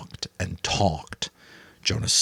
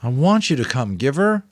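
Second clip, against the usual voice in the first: higher, louder plea rising on Giver; pleading boy; 8 semitones higher, 6 dB louder than usual. A narrator's reading. Jonas begging the Giver to come with him, pleading. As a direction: beg him to come along. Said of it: "Jonas pleaded".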